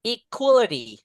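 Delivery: high, clear model words for the class to echo; repeat-after-me drill. Shown as a demonstration. Teacher leading the class in a repeat-after-me drill, high and clear. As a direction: call out each word to be repeated. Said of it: "repeat after me".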